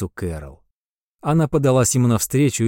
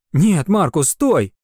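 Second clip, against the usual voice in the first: urgent shout; 6 semitones higher, slower than usual. Acted. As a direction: call out to stop him. Carol stops Marcus drawing sword.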